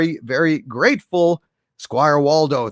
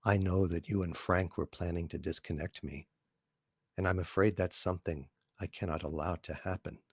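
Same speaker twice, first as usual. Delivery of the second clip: much lower and much softer, calm and even; HAL computer voice; 11 semitones lower, 17 dB quieter than usual. Acted. The ship computer answering astronaut Dave, low, calm and soft. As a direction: speak low, soft and unhurried like a polite machine. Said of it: "from two thousand and one, A Space Odyssey".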